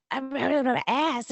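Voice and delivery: put-on high, loud, slow toddler threat; toddler threat imitation. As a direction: mimic a tiny toddler trying to sound tough. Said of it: "she'll be like".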